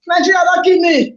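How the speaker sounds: high pleading cry; mock anguish. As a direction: beg the team to stop the pain. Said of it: "Do am in the pidgin lifestyle".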